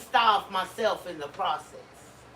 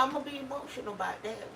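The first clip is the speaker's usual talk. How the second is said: usual speaking voice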